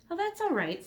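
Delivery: put-on high, light boy voice; bright young Elmer.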